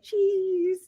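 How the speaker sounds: high, held-out cheese; posing for camera. Retold or imitated.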